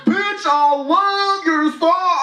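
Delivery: high, slow, breathless fan gush; hammed-up swooning fan. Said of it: "this is how the interaction went".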